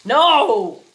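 loud, high disbelieving shout; shocked outburst. Real, not acted. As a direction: blurt it out in disbelief. Arguing over relish expiry date.

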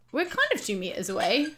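normal talking voice

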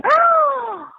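high-pitched squeals between wailed realisations; hammed-up shock. Showing her attitude on learning who killed Diana, theatrical shock. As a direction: act out a scandalised discovery, very high. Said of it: "mi actitud en ese momento fue que".

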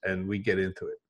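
slipping into a Philly accent. Real, not acted. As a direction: Philadelphia accent coming through. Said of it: "I'm going into my Philly accent right now".

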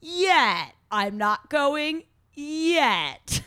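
hammed-up dramatic boyfriend dragging out the twist; ominous tease. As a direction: draw out the cliffhanger line. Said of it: "And then he goes".

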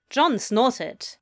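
plain reading voice

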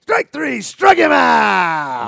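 loud, long drawn-out strike call; umpire calling strikes. Retold or imitated. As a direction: stretch the final words out like an umpire. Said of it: "Long drawn out".

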